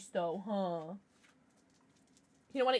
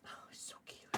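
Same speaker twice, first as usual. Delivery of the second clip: soft whispered coo; 22 dB quieter, faster than usual. Real, not acted. Owner cooing at her sleeping dog, whispered. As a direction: whisper it so the dog stays asleep.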